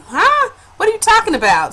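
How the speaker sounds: high-pitched mock bewilderment; baffled surprise. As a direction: act out being caught off guard, voice jumping up. Acts out her baffled reaction.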